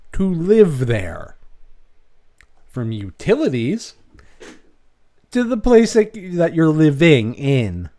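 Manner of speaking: exasperated rant. Real, not acted.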